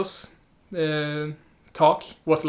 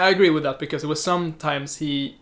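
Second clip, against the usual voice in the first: ordinary talk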